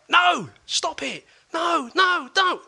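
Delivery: high, loud, slow desperate shout; retold screaming. Retelling a girl screaming at school, loud and high. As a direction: cry out like a frightened girl. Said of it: "I heard this girl screaming".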